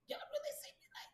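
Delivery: breathless high whisper of disbelief; whispered retort. Retold or imitated. Whispering back at people who called her names. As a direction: hiss it out in stunned disbelief.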